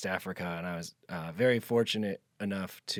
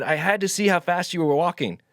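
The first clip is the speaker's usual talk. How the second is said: raised, high shouted explanation; calling back; 7 semitones higher, 6 dB louder than usual. Retold or imitated. Farmer explaining himself to departing traveler, calling back. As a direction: call the answer across the distance.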